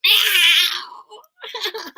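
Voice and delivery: loud, far cat meow. Acted. Cat accepts the party invitation with a loud meow. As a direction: yowl it like a cat.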